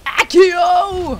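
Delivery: high, loud spell cast; mock wizard yelling the spell; shouted spell. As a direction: call it like a wizard casting. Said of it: "hvordan var Accio?".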